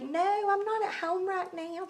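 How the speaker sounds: lifted high voice imitating a neighbor's way of talking; imitating neighbor Lee. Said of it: "That's how she talks".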